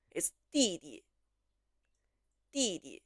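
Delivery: 弟弟 with the first 弟 falling sharply, the second light; first 弟 a falling fourth tone, second 弟 a soft neutral tone; teacher models 弟弟, falling tone then light tone; tone demo. Shown as a demonstration. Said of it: "第一个弟是四声".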